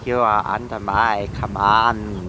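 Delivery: low, slow booming order; deep commanding voice. Acted. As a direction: command like a god.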